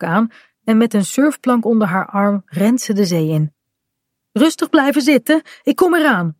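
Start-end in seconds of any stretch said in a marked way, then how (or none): 4.3-5.4 higher, lightly raised call. A narrator's reading. Mother runs into the sea to the children, calling out. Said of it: "roept ze". 5.6-6.4 higher, lightly raised call. A narrator's reading. Mother runs into the sea to the children, calling out. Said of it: "roept ze".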